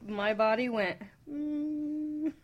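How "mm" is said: normal talking voice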